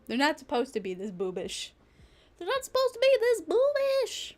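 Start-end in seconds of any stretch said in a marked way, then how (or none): 2.4-4.3 very high, louder comic squeal; mock outcry. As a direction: repeat it as a squealed, hammed-up protest. Repeats it in mock outrage.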